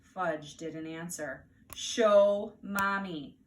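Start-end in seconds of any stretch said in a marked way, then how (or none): 1.7-3.4 very slow, loud firm order; stern command. Acted. Mother orders Fudge to open up, slow loud command. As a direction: lay down the law, word by word. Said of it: "mother demands".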